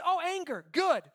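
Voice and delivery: high, loud, punchy exclamation; emphatic mock approval. God turns Jonah's anger around, emphatic approval.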